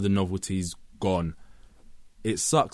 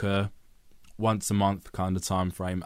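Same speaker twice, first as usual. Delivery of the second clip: normal talking voice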